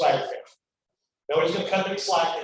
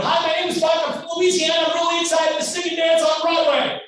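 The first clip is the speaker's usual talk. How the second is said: a much higher, bright-eyed hopeful voice; eager young actor; 9 semitones higher than usual. Retold or imitated. Young actor pitching himself to casting directors, high and eager. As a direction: sound like a naive kid from Louisiana selling himself.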